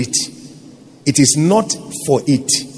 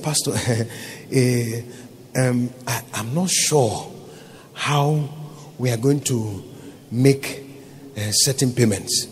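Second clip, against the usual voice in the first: softer, halting, unsure; nervous hesitant accountant; 6 semitones lower, 8 dB quieter than usual. Retold or imitated. Accountant telling the pastor about payments, timid and halting. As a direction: trail off like a worried employee.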